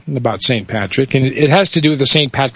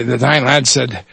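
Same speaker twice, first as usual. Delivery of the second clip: ordinary talk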